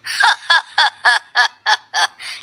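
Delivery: long booming belly laugh; big laugh. Acted. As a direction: laugh hard like an ox. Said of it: "बैल की तरह क्यों हंसे".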